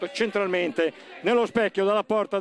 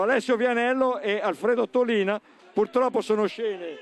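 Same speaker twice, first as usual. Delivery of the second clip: ordinary talk, nothing marked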